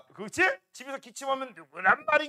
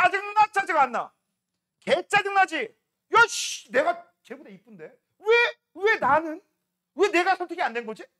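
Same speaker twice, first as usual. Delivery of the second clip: higher, louder indignant whine; jealous outburst; 7 semitones higher, 7 dB louder than usual. Acted. Rejected star protesting to the class, loud and jealous. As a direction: complain like the spurned rival.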